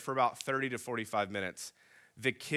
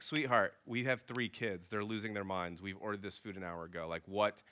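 softer, patient and reasonable; calm reasoning; 6 semitones lower, 8 dB quieter than usual. Retold or imitated. Wife reasoning patiently with the server, softly. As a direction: talk her down gently. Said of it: "Alyssa's trying to reason with her".

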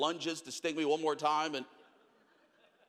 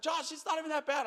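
pastor's reassurance; 10 semitones higher than usual. Retold or imitated. Youth pastor quoted.

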